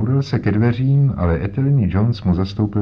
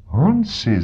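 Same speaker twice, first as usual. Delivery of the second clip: surprised call; 9 semitones higher than usual. Acted. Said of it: "zvolal jsem".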